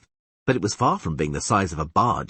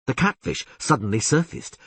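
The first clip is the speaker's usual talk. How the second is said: usual speaking voice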